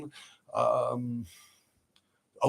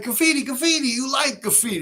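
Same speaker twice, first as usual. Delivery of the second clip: put-on higher, sing-song French accent on graffiti, graffiti; mock French accent; 10 semitones higher than usual. Taunting the French truck owner in a put-on French accent. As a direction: tease the Frenchman with his own accent. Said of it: "But he talked with, like, a French accent".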